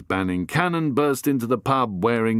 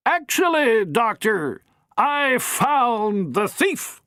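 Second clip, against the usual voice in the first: high, slow, triumphant grande dame; triumphant matron; 12 semitones higher, slower than usual. Acted. Matron announces the thief to the Doctor, triumphant. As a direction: savour the reveal.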